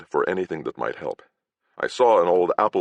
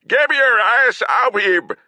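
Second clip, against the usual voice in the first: slow, much higher and louder raging yell; furious knight; 7 dB louder than usual. Acted. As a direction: spit each word in fury. Agravain yelling at Kern.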